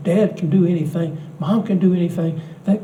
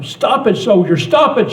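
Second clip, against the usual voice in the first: put-on high, pleading yell of a small girl; hysterical child scream; 5 semitones higher, 3 dB louder than usual. Granddaughter screaming at the soldiers, high mock yell. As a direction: cry out at the soldiers, mildly hammed up. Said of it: "she screamed".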